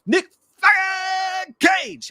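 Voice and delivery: high, drawn-out yell; exasperated shout. Real, not acted. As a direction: stretch the middle word into a long, high holler. Exasperated emphatic shout of actor's name.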